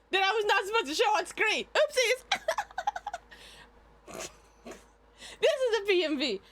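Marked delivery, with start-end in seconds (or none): 2.3-3.2 high giggle. Real, not acted. After the wrong video on screen.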